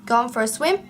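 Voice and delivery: ordinary delivery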